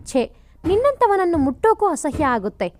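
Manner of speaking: understated indignant outburst; angry disgust. Acted. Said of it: "ಕೋಪ ಜಾಸ್ತಿಯಾಗಿ".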